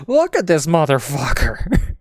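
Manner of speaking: slow, loud, high-pitched mock amazement; imitating wrestler. Commentator imitating Lesnar turning to Heyman, incredulous. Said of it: "Lesnar looks to Heyman and goes".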